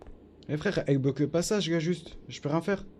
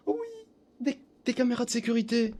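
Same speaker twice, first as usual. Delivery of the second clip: sudden delighted discovery; surprised gasp; 11 semitones higher than usual. Real, not acted. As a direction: gasp then high excited find. Découverte soudaine. (surpris, voix aiguë de découverte)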